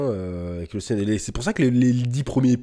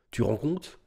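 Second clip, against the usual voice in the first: softer stunned question; understated disbelief; 5 dB quieter, slower than usual. Real, not acted. Stunned rhetorical question, first take. (incrédulité retenue)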